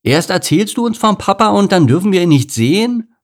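put-on high, loud childish protest; high-pitched indignant whine; indignant lion cub. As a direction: complain like an outraged kid.

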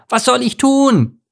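high, slow, loud panicked cry; drowning duckling crying out loud; shout. Acted. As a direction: yell for help while sinking. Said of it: "schrie er".

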